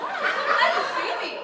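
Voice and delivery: high, slow teasing voice; sarcastic. Acted. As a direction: draw it out mockingly. Servant mocking.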